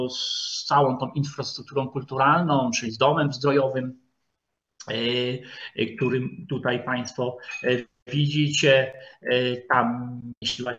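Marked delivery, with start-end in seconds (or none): none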